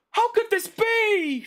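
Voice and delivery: high, drawn-out mock horror; mock dismay. Streamer acting out being shocked by an insult, mock dismay. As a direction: wail it in hammed-up disbelief. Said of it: "look at myself and go like".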